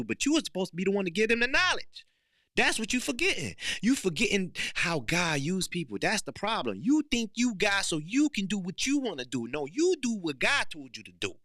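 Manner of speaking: high, loud preaching push. Real, not acted. Speaker preaching to viewers about God's purpose, high and loud. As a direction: call them out like a preacher.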